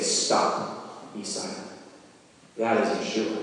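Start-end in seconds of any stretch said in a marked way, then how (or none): 0.0-1.6 hushed command. Acted. Jesus calming the wind and waves.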